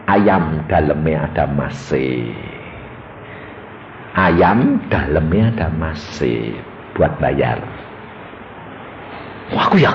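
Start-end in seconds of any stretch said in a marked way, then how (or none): 0.1-7.6 low, very slow divine announcement; voice of God. Retold or imitated. God tells the husband what the chicken holds, low slow announcement. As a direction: drop the pitch and spell it out slowly. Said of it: "Tuhan ngomong".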